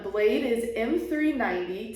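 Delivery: ordinary delivery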